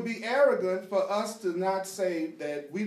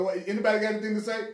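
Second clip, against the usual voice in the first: laughing; 8 semitones higher, 9 dB louder, much faster than usual. Retold or imitated. Mimicking the room, laughing.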